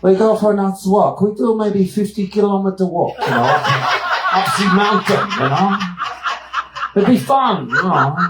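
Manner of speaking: high, bright mock Austrian woman, laughing through it; Austrian accent. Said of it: "You'll know this being from Switzerland".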